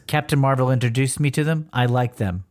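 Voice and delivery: ordinary talk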